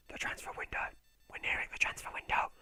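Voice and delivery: hushed whisper; whispered aside. Real, not acted. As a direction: whisper it like a secret.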